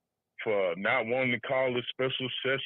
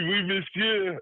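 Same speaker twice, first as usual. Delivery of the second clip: mock French; 8 semitones higher, 4 dB louder, slower than usual. Joking French exchange.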